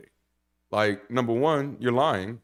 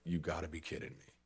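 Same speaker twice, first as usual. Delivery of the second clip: much softer, muttered aside; under her breath; 5 semitones lower, 14 dB quieter than usual. Retold or imitated. Employee being coached, muttering much softer under her breath. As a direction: say it low so only you hear it. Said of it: "she said under her breath".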